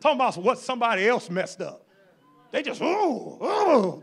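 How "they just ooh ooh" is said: mock frustrated groan. Mocking a frustrated person.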